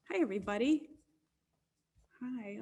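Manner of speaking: ordinary talk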